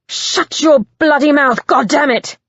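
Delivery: loud, furious roar of a command; enraged yell. Acted. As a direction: lose control on the words. Simon enraged.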